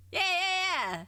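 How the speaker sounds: excited yeah. Real, not acted. Excited agreement after winning point.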